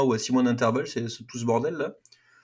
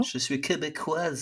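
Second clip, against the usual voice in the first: put-on English accent; 3 semitones higher, 6 dB quieter, slower than usual. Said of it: "elle le dit avec un accent anglais".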